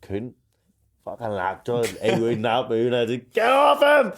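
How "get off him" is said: high, loud shout at a dog; sudden pitched-up bellow of command; re-enacted yell. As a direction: act out yelling to break up a dog fight. Re-enacts yelling at his dog.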